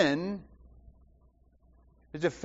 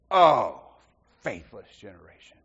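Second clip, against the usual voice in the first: weary exasperation; 10 semitones lower, 4 dB louder than usual. Retold or imitated. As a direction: let "faithless generation" sink into a tired sigh. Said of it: "It is a statement of exasperation".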